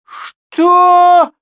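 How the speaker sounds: very high, very loud shocked cry; outraged what. A narrator's reading. Mothers of the pupils cry out in shock. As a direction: leap up in outrage.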